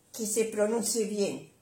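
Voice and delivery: ordinary talk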